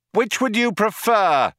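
high, projected retort; Ford shouting back. A narrator's reading. Ford answers the cops' ultimatum, shouting. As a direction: call the question across to them. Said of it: "shouted Ford".

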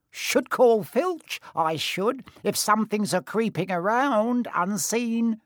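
high, slow, sneaky threat; sly poltergeist. Acted. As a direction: drag it out like a prankster. Peeves threatens.

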